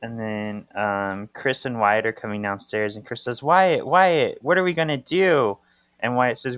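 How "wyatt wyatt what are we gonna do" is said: put-on higher, mildly worried child's plea; lightly acted urgency. Host voicing Chris asking Wyatt for help, mildly urgent. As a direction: plead lightly, pitch lifted, hurrying at the end.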